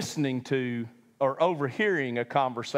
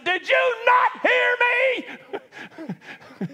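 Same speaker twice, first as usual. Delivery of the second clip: mock angry shout; 5 dB louder than usual. Preacher as an exasperated Jesus scolding disciples, mock shout. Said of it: "and I said".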